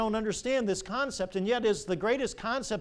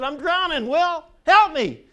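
high, loud desperate shout for help; panicked cry, rising to a yell; drowning cry; 9 semitones higher, 10 dB louder than usual. Acted. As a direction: scream it to the boy in the canoe. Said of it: "I said".

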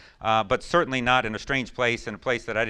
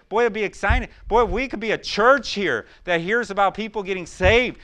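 higher, louder revival preaching; fervent preaching; 8 semitones higher, 7 dB louder than usual. Acted. Pastor urging the church about soul winning, fervent preaching. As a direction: stir the congregation up.

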